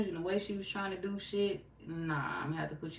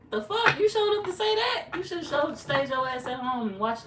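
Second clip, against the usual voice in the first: high, loud incredulous outburst; outraged disbelief; 9 semitones higher, 6 dB louder than usual. Acted. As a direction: blow up in mock outrage at the nerve. Acted outraged reaction to retold remark.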